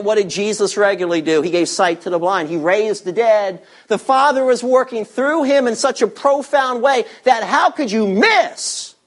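normal talking voice

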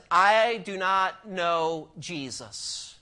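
a touch of Peter's stubborn denial; slow emphatic denial. Retold or imitated. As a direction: deny it word by word, slowly. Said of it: "he says".

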